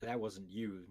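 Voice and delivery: ordinary talk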